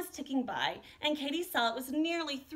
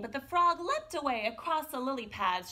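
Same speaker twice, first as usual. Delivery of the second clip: ordinary delivery, nothing marked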